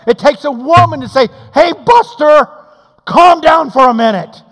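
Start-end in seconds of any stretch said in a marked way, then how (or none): 1.5-4.4 loud, high bossy wife; wife loudly telling husband to calm down; mock wife scolding. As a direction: tell him off to calm him. Wife calming angry husband.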